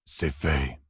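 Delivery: a hint of something inhuman answering calmly; eerie flat reply. A narrator's reading. Strange man calmly telling Matt it is done, low and soft. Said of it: "dit un des hommes".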